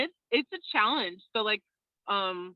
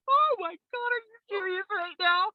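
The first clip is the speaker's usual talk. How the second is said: higher, amazed gasp of disbelief; stunned disbelief; 11 semitones higher than usual. Retold or imitated. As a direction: replay the shock of the proposal, pitched up. Reacting to proposal on zip line.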